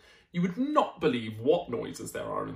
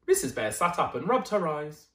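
usual speaking voice

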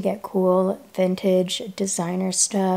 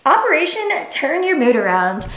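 upbeat announcement; 7 semitones higher, 5 dB louder than usual. Real, not acted. Announcing her mood plan.

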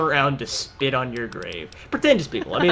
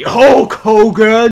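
loud, drawn-out wrestler yell; battle cry; 6 semitones higher, 10 dB louder, much slower than usual. Pretend. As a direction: bellow it like a wrestler. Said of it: "occasionally say".

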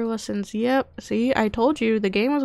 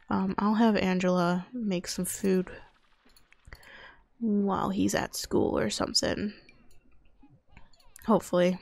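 ordinary talk

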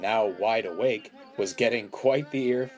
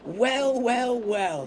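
very high, loud teasing sing-out; gleeful mocking character voice; chuckling; 14 semitones higher, 4 dB louder, much slower than usual. A narrator's reading. Percy arrives to find George on the train, chuckling. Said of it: "he chuckled".

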